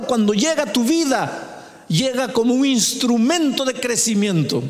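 high-pitched, driving sermon delivery; high, insistent pulpit oratory; impassioned preaching. Acted. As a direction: preach it with rising intensity.

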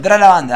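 "dra la banda" with toca clipped to dra; slurred Santa Fe slang. Shown as a demonstration. Host imitating the Santa Fe slur, "dra la banda" said fast. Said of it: "sonaba dra la banda".

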